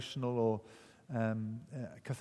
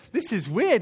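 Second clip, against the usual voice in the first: puzzled; 7 semitones higher, 7 dB louder than usual. Retold or imitated. Prisoner reacting to singing.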